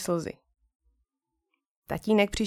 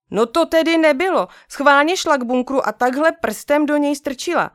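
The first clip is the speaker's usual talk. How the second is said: high, loud indignant outburst; indignant child; 5 semitones higher, 5 dB louder than usual. Acted. Boy tells dad, indignant and loud. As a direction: protest loudly, voice up high. Angry boy about sister.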